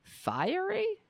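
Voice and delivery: high, sceptical Fiery?; incredulous. Real, not acted. Reader mocking a headline, high and incredulous. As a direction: scoff at the word Fiery in disbelief.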